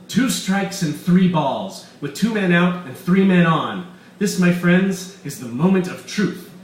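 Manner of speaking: loud, gloating mad scientist voice; villain Weiss. Acted. Doctor Weiss gloating to his captives, loud and smug. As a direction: boast like a scheming villain.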